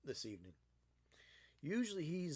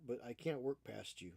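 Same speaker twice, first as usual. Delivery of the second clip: ordinary talk, nothing marked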